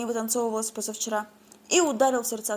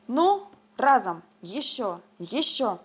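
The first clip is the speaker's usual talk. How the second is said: grunting out each heave, slow and louder; lifting with all his strength, drawn out; straining effort; 4 dB louder, much slower than usual. Acted. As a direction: heave a heavy cauldron with each word. Straining to lift the cauldron.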